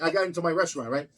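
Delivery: ordinary talk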